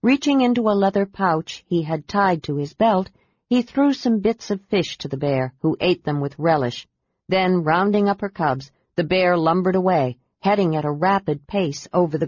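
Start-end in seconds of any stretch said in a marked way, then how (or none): none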